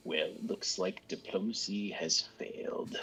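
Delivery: whispered aside; under his breath. Acted. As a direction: mutter it under your breath. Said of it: "under his breath".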